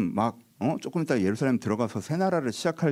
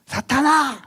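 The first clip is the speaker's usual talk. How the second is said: high, loud cry of rebuke; rebuking shout; 12 semitones higher, 6 dB louder, much slower than usual. Retold or imitated. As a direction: hurl it out like a curse. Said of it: "욕을 이제 바가지로 쳐". (크고 높게 꾸짖는 외침)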